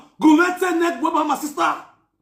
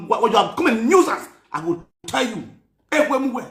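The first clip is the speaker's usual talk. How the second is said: normal talking voice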